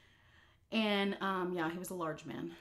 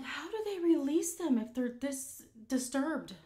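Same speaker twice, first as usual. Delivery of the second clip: shocked; 8 semitones higher, 4 dB louder, slower than usual. Retold or imitated. Shocked question to guard.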